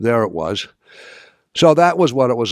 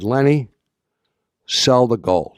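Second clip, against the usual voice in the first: insistent order; 4 semitones higher, 5 dB louder than usual. Retold or imitated. Ordering partner to sell gold.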